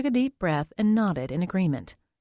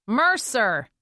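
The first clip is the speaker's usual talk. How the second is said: calling out; 4 semitones higher, 4 dB louder than usual. Acted. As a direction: call "Mercer!" down the hall. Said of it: "Weiss called".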